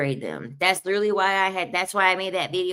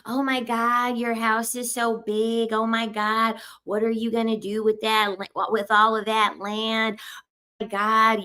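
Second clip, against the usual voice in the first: higher, sugary fake praise, slowing on the compliments; mock gushing admiration; 4 semitones higher than usual. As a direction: ham up being impressed by his house. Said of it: "they want you to be like".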